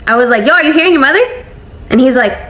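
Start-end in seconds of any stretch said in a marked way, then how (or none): 0.5-1.2 higher, louder incredulous call; retold disbelief. Telling the husband to hear his mother's threat, incredulous. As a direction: call him over in disbelief.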